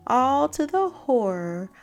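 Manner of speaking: ordinary delivery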